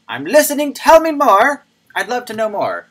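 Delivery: high, loud mock-eager voice; eager listener. Streamer mimicking his own eager reaction to a tweet, hammed-up high voice. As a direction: play the keen listener hanging on every word. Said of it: "I was like".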